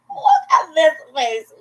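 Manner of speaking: high, loud, drawn-out gush; over-the-top cutesy squeal of words; playful gushing. Pretend. Repeats it, gushing harder.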